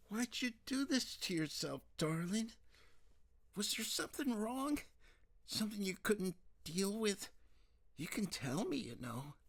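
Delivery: pleading mother. Acted. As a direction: coax the daughter gently, as a worried mom.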